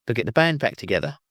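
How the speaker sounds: usual speaking voice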